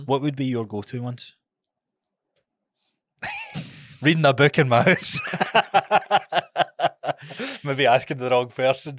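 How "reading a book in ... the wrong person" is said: louder, laughing through the words; laughing at his own answer about staying in. Real, not acted. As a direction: joke while cracking up.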